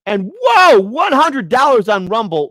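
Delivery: high and loud, slowed in delight; thrilled by a big donation; excited whoa. Real, not acted. Hundred dollar rant arrives.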